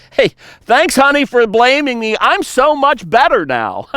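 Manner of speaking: high, bright mock gratitude, dripping with sarcasm; sarcastic thanks. A husband mockingly thanks his wife for blaming him, high sarcastic gratitude. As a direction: thank her as if blame fixed you.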